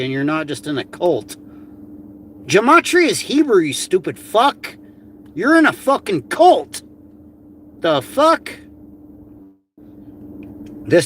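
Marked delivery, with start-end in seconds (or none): 2.5-8.5 angry outburst. Real, not acted. Yelling at her cult involvement.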